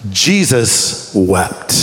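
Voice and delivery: "Jesus wept" very slow and low; grave, low, long pause inside "Jesus wept"; dramatic, weighted answer. Shown as a demonstration. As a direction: stretch "Jesus wept" out, word by word. Two-word answer given with dramatic weight.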